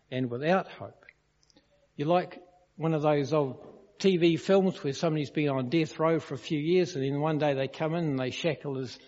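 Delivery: ordinary talk, nothing marked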